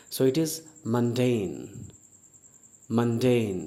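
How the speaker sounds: mundane as "MUN-dane", clearly with a short u; mundane said right. Shown as a demonstration. Teacher to students: mundane pronounced MUN-dane, slowly. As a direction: say mundane as MUN-deyn, not mun-den. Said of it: "इसे मुंडेन नहीं पढ़ते हैं".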